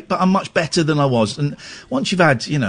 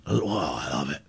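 whisper; 14 semitones lower, 10 dB quieter than usual. Real, not acted. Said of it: "I love a Glasgow accent".

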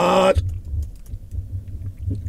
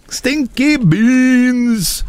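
slow, higher announcer call; drawn-out show title; 6 semitones higher, much slower than usual. Pretend. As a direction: stretch it out like a show opener. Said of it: "That's what she calls her show".

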